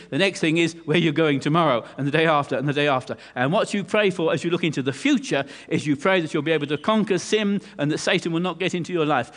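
ordinary talk

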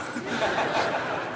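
a quick airy chuckle; soft breathy laugh. Real, not acted. Preacher laughing at his own quip to the congregation. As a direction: laugh under your breath at the joke.

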